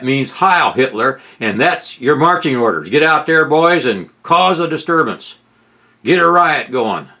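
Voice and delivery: loud mock call to the troops, sending the boys out; hammed-up marching orders, pushed out loud; mock rallying call. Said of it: "That's what he's saying".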